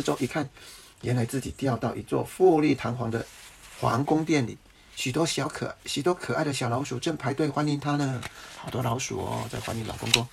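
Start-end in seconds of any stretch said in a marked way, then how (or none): none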